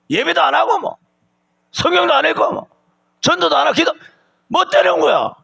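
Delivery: high, pressing preacher's cry; heated preaching. Acted. Pastor scolding believers who neglect the law, in a high heated cry.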